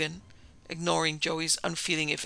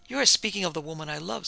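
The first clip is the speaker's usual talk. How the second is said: plain reading voice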